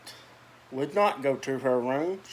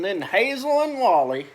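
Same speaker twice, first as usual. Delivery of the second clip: usual speaking voice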